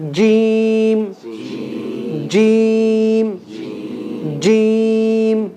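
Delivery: jeem held long and chanted for repeating; drawn-out jeem. Shown as a demonstration. Teacher chants jeem for students to echo. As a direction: intone jeem slowly for the class. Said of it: "read each letter a few times".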